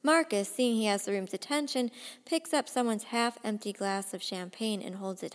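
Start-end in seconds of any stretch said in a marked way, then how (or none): none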